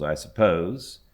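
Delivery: plain reading voice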